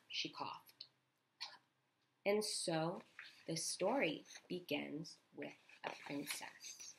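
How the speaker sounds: slow whispered bedtime story; hushed storytelling whisper. A narrator's reading. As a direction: whisper it like a fairy tale opening.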